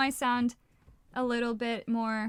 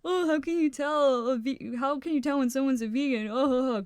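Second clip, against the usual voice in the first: mocking imitation; 3 semitones higher, 3 dB louder, faster than usual. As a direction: mimic people cracking a tired vegan joke. Mimicking people telling a vegan joke.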